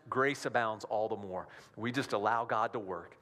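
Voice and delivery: usual speaking voice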